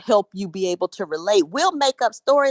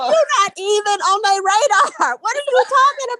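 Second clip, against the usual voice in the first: much higher and louder, words through laughter; laughing; 11 semitones higher, 5 dB louder than usual. Real, not acted. Still laughing as she tells her friend the point. Laughing fit while explaining.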